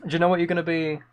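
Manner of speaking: ordinary delivery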